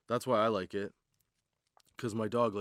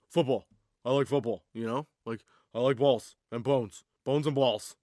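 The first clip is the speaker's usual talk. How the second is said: louder dopey jock voice; dumb meathead; 6 dB louder than usual. Pretend. The meathead dog bragging, lightly voiced dopey jock. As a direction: play the dim muscle-head dog.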